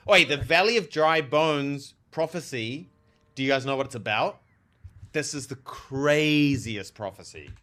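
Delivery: normal talking voice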